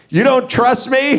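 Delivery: high-pitched disbelieving question; mock incredulity. Retelling a dispute with the lot attendant, incredulous. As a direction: act out wounded disbelief, pitch up. Retold quarrel with parking attendant.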